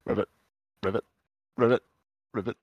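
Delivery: croaking ribbits; frog. Shown as a demonstration.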